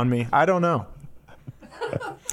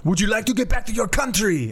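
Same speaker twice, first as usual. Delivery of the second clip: French caller voice; 8 semitones higher than usual. Retold or imitated. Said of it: "He goes".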